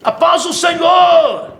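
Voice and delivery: long called greeting from a hilltop; yelling across the room. Retold or imitated. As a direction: call it out slowly from far above. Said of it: "alguém gritou lá de cima".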